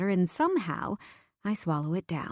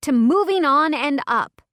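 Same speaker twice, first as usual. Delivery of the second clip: calling out a toast, loud; loud cheer; 7 semitones higher, 8 dB louder than usual. Acted. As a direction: raise the glass and shout it. Drunken toast.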